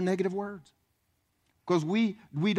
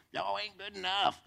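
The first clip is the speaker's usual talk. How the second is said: mock evil preacher; 3 semitones higher, 6 dB quieter than usual. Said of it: "one of those evil preachers".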